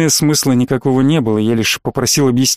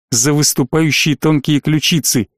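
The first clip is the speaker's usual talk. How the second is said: plain reading voice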